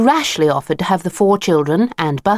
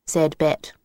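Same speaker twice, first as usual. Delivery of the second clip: plain reading voice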